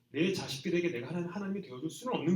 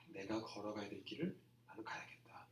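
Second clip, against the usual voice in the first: whispered resolve; 6 semitones lower, 12 dB quieter than usual. Retold or imitated. Quiet resolve of Jesus.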